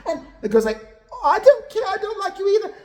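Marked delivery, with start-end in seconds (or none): 1.1-2.8 very high, loud mock comeback; mock retort. Girl answering Kim's insults, very high imitated retort. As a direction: squeal it back as the offended girl.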